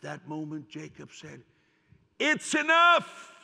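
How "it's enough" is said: a father's cry, it's enough; loud slow cry of resolve. Acted. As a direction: stretch it out, loud and high. Jacob deciding to go.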